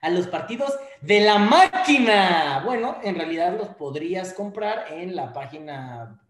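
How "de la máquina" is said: hype call. Acted. Hyping the team nickname.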